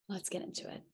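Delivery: breathy whisper to herself. Real, not acted. Coach whispering to herself before the mindset section.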